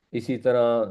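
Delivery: ordinary delivery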